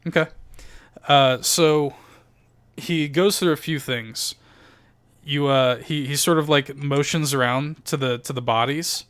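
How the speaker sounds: usual speaking voice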